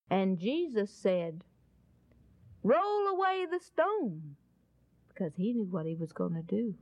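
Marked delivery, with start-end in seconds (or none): 2.6-4.4 high, slow, drawn-out order; acted-out command, stretched and raised in pitch; solemn command. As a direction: give the order slowly and grandly. Jesus commands at the tomb.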